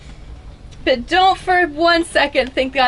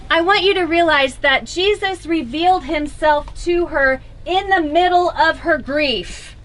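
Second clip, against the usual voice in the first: ordinary talk, nothing marked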